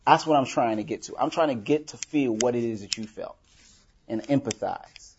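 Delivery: ordinary talk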